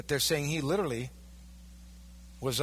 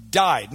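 "Died" alone, much louder, falling; emphatic word; 8 dB louder than usual. Shown as a demonstration. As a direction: hit "Died" hard like a verdict. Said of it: "The language is emphatic".

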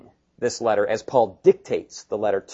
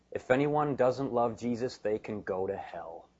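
quiet restatement; 5 dB quieter than usual. Retold or imitated. Preacher puts Paul's curse bluntly to the church, quietly.

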